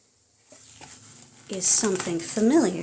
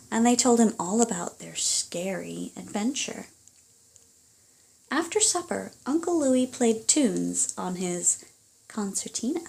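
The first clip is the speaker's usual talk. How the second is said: lively storybook reading aloud; reading to children; 2 semitones higher, 4 dB louder than usual. Acted. Parent reading a picture book to a child, animated storytelling. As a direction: read it like a bedtime story to little ones.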